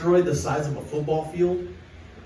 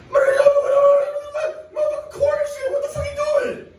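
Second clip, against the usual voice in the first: loose Philly accent impression, pitched way up on the yell; weird Philly accent; 7 dB louder, much faster than usual. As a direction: imitate a Philly guy flipping out in a Philly accent. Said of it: "In a fucking weird Philly accent".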